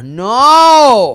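loud, high mock wail of protest; frustrated shout. On hearing of a detour, a loud mock wail. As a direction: shout no at the bad news.

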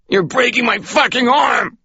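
high, loud pained outcry from a pinned man; pained growl. Acted. Pinned Ray cries out at the officer twisting his arm, high pained yell. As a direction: yell it through the pain, voice cracking up high. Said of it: "Ray growled, or tried to".

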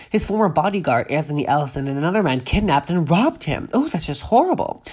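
normal talking voice

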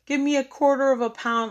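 रूखा, माँगने वाला लहजा; mock demanding customer. Mocking a rude customer demand.

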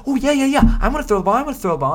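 very high, loud, squealing excitement; giddy quarterback. Pretend. Quarterback thrilled to get the ball, squeaky and loud. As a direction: bounce like an overjoyed kid. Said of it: "and he's go".

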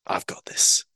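hushed, whispered menace; whispered line. Retold or imitated. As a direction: say it low and deadly under your breath. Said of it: "Nebula pulls out a gun and is like".

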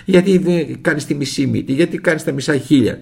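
put-on high, loud, pestering questions from a child; nagging a busy father at full voice; retold child's shouting. Child pestering painter father, shouting high and loud. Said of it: "φώναζα".

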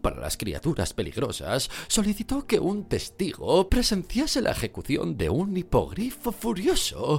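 high, hearty official voice; overly friendly politician; minister character voice. A narrator's reading. Fudge voiced in story.

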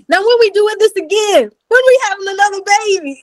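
high, keen question; the hyped doctor pressing for another baby; eager doctor. Retold or imitated. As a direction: ask it pumped up and eager. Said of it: "he's like".

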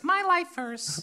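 high, slow and exaggerated, like a signature line; mock email sign-off.

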